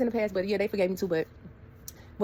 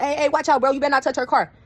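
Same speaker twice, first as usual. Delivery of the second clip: louder, higher warning; warning shout; 6 semitones higher, 6 dB louder than usual. Retold or imitated. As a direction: warn them off loudly. Brother warning them off the car.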